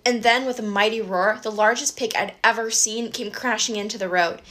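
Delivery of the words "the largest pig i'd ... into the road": plain reading voice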